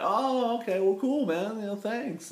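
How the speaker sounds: mock-casual thanks in a very high voice; high-voiced reply. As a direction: retell it in a small, high voice. Acts out own flustered reply to fan.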